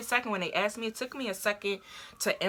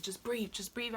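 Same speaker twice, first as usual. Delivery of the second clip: hushed, much softer voice; retold coaching mom; 3 semitones higher, 9 dB quieter than usual. Mom tells her to breathe, softly imitated. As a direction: coach someone softly through pain.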